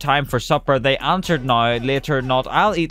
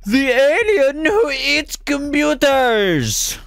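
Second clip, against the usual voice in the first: booming announcer; 12 semitones higher, 4 dB louder, slower than usual. Acted. Title announced with music.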